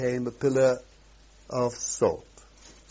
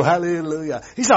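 normal talking voice

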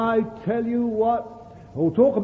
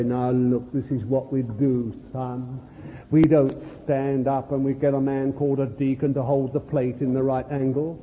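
put-on low, soft voice to a child; parent explaining; 7 semitones lower, 3 dB quieter than usual. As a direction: quietly explain it to your son. Parent explains church rite to child.

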